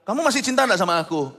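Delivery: high, loud pleading question; acted wife. Wife asks husband if he still loves her, acted high plea. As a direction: imitate a wife demanding reassurance. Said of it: "Kalau sampai istri tanya sama Anda".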